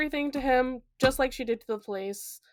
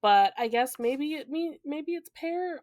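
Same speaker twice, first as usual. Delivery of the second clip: usual speaking voice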